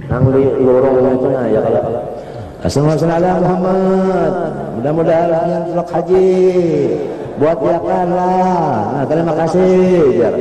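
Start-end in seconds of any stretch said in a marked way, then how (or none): 2.6-10.0 high, drawn-out appeal; donation collector's call. Retold or imitated. Collector asking passers-by for mosque donations, high drawn-out call. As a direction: imitate a collector chanting thanks, pitched up and slow.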